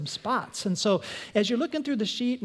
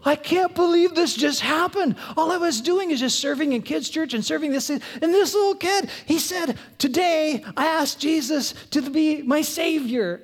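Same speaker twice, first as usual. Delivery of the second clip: higher, louder, breathless joy; thrilled amazement; 7 semitones higher, 3 dB louder than usual. Retold or imitated. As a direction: gush about what just happened. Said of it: "you're gonna go".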